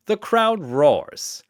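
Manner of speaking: ordinary delivery, nothing marked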